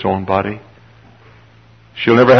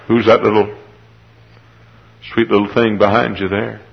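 ordinary talk

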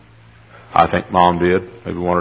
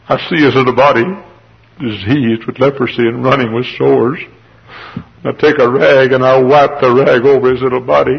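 anguished confession, building; 4 semitones higher, 3 dB louder than usual. Acted. Leprous father blames himself for son's sickness, anguish building. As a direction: start raised, climb to a high loud outcry. Gehazi laments his sick son, building.

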